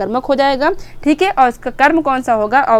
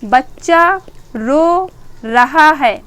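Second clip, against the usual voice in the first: very slow, drawn out; much slower than usual. Shown as a demonstration. Teacher re-says the example to students, very slow and drawn out. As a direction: stretch "बच्चा रो रहा है" very slowly, every word long. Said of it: "अगर मैंने कहा".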